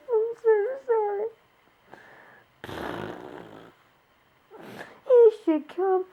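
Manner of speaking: high, tearful voice breaking; apologizing through tears; real sobbing words; crying. Real, not acted. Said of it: "I'm gonna try not to cry anymore".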